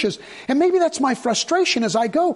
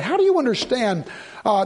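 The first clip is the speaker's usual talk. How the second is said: ordinary talk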